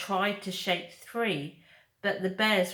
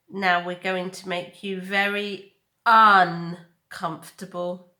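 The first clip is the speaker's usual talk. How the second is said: louder, menacing bear; loud bear threat; 9 dB louder than usual. Acted. Bear Nettle threatening the robot, louder. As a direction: lean hard into the threat.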